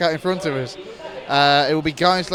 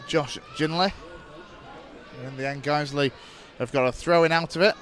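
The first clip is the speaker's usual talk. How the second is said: steady radio match commentary; live play-by-play; 2 semitones lower, 5 dB quieter than usual. Acted. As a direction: call the match as it unfolds.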